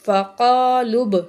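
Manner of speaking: slow, drawn-out tajweed chanting; Quran recitation. Shown as a demonstration. Teacher recites the verse for students, slow melodic chant. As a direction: recite slowly with full nasal hum.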